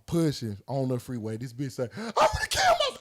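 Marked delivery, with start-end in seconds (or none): 2.0-3.0 very high squeaky mock girlfriend; imitating girlfriend, hammed-up; mock girlfriend threat.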